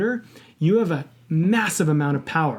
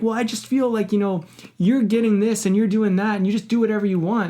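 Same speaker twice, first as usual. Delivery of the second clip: higher-pitched imitation of an aggrieved friend; retold grievance; 6 semitones higher than usual. Upset friend airs grievances to him, retold mild anger. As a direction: list complaints like a miffed buddy. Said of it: "you seem really angry about it".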